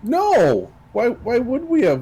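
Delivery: high-pitched, baffled protest; indignant denial. Acted. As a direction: answer back in loud disbelief. Player indignantly answers the driver.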